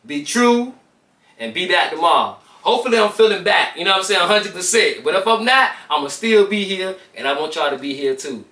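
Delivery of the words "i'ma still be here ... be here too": usual speaking voice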